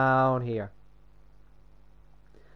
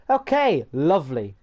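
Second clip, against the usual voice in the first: mock-cheery; 2 semitones higher, 4 dB louder than usual. Real, not acted. Told the firebox is missing, mock-cheery. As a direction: chirp it far above your usual pitch.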